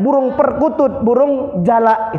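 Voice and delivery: high, chanted list like a mock pantun; penutup pidato jenaka. Shown as a demonstration. Mock closing rhyme of a speech. (pantun penutup bernada tinggi)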